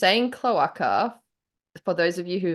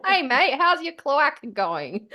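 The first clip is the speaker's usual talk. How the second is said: put-on Australian accent; 7 semitones higher than usual. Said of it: "sounds like an Australian accent".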